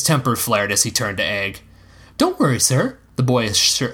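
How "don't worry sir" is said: put-on smirking, high boyish assurance; high, cheeky boy's voice; Egg. A narrator's reading. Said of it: "assured him with a smirk".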